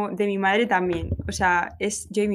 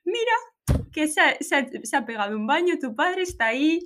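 high excited mother voice; imitating mom; 6 semitones higher than usual. Mother telling daughter dad is swimming, high and excited. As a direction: pitch up as the mom who spots him. Mother thinks she sees husband.

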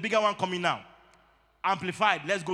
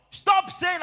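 high, loud stop-command to the congregation; sharp rebuke; 10 semitones higher, 5 dB louder than usual. Real, not acted. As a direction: cut them off hard, higher and louder. Preacher rebukes wrong confession.

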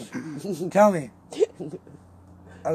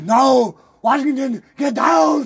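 retold shout; 6 semitones higher, 6 dB louder, slower than usual. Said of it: "I was like".

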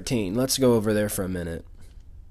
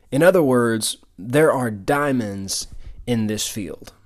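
ordinary delivery

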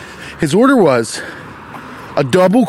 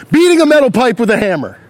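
raised, higher, fast jab at the workers; irritated yell; 6 semitones higher than usual. Real, not acted. Podcaster at street worker banging a pipe, irritated yell. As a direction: yell it toward the noisy neighbours. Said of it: "they heard me yell".

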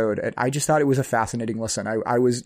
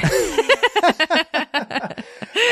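high burst of laughter. Real, not acted. Laughing at monster truck dreams.

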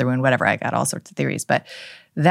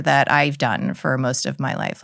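ordinary talk, nothing marked

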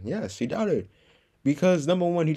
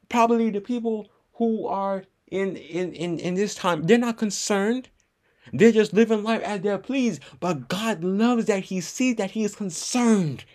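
earnest preaching; 8 semitones higher, 4 dB louder than usual. Real, not acted. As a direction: press each phrase home. Preaching at raised pitch.

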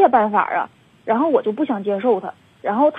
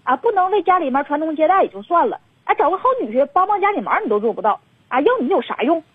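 retold scolding in a higher, faster voice; imitating a grandma's shrill-free, higher, quick rebuke; 6 semitones higher than usual. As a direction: mimic grandma berating her son. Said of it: "完了骂我爸说".

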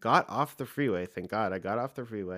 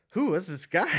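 incredulous question; 8 semitones higher, 4 dB louder than usual. Retold or imitated. Reacting to insurance guy's story.

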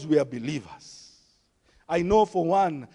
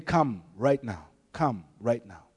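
gentle call; 9 semitones lower, 5 dB quieter than usual. Retold or imitated. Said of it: "God is saying".

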